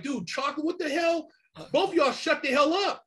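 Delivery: high, incredulous telling-off; high exasperated shout to shut up; exasperated scold. Retold or imitated. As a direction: snap at two bickering dudes. Scolding two arguing robbers.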